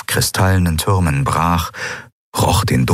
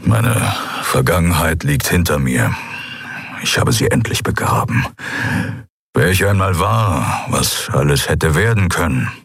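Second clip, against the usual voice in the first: halting, understated confession; brooding man, resolute. Acted. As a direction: search for the words, then state them firmly.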